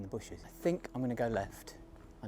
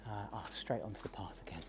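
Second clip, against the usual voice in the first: whispering; 3 semitones lower, 9 dB quieter than usual. Real, not acted. Near cows, hushed.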